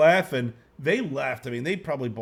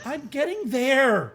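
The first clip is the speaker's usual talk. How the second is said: irritated, slow and higher: I'm getting there; grouchy; 11 semitones higher, 4 dB louder, slower than usual. Real, not acted. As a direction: drag out "I'm getting there" with annoyance, getting pitched up. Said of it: "he's so grouchy tonight".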